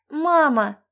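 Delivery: put-on a high child calling, half-asleep; sleepy child. A narrator's reading. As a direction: call out like a drowsy little boy. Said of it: "сонный голос сына". (сонный детский голос)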